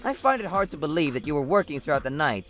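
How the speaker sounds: ordinary delivery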